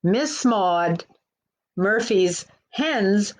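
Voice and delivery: plain reading voice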